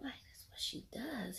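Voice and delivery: slow disbelieving whisper; stunned whisper. Retold or imitated. Echoing the husband at the funeral, a stunned whisper. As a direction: whisper it back in shock.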